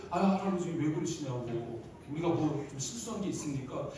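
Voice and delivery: placating staff. Acted. Staff calming the shouting grandpa.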